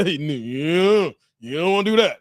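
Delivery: low-voiced imitation of dad; Bill Jordan impression. Dad cautions his son, imitated low. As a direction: drop into a father's deep cautioning voice. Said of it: "that's how Bill Jordan would talk".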